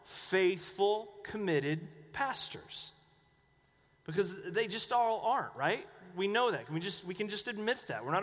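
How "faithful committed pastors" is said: "faithful, committed" drawn out slowly, word by word; slow, weighty "faithful... committed... pastors"; stress on FAITHFUL, COMMITTED. Real, not acted. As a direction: lean hard on "faithful" and "committed", pausing between them. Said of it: "Emphasis on".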